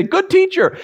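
high, fawning mock greeting; mock flattery. Pharisees flattering Jesus, high and fawning. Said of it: "come to him and say".

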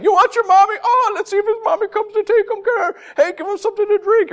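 high sneering mock voice; high-pitched jeering taunt; mocking crowd. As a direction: taunt him like a jeering bystander. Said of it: "they're ridiculing him".

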